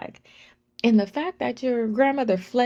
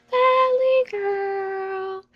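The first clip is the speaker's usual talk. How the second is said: put-on high, slow, sneering valley girl; mocking stepdad; 13 semitones higher, 4 dB louder, much slower than usual. Stepdad insulting her, high drawn-out mock. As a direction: stretch it out as a taunt. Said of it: "He'd be like".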